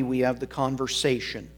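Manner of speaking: ordinary delivery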